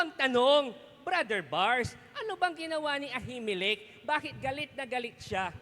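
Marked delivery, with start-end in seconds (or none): none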